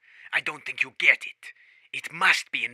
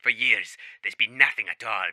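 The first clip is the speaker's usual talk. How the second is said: normal talking voice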